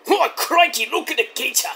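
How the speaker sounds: high, hammed-up Aussie wildlife host; Steve Irwin. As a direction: exclaim in a put-on Australian accent. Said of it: "do their best Steve Irwin impressions".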